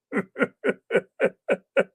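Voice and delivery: high, rhythmic chuckle in a long run; real burst of amused laughter; hearty laugh. Real, not acted. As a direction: laugh heartily at your own remark. Amused by own funny-talk remark.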